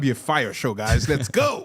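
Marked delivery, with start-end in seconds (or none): 1.1-1.7 pitched way up, rising "go"; hype call. Real, not acted. Host opening the podcast with an excited high hype call. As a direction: fire up the audience to start. Host hyping the show open.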